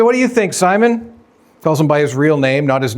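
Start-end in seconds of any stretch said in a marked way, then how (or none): 0.0-1.1 lifted, higher searching question; Jesus asking Simon, pitched up. Shown as a demonstration. Jesus turning to Simon, asking in a higher voice. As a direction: raise the pitch and ask Simon warmly. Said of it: "Jesus said".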